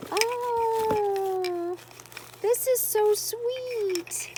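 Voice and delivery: high, slow cooing gush; touched by a friend's note, high coo. Real, not acted. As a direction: coo it like something adorable.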